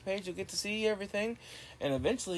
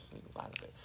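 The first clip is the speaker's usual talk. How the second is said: creepy whisper; 12 dB quieter, much faster than usual. Pretend. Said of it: "It's not creepy".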